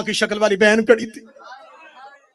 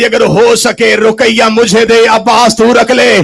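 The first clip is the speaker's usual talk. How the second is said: crying, pleading shout; 3 semitones higher, 12 dB louder than usual. Acted. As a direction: cry out the request to the brother. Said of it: "रो कहती".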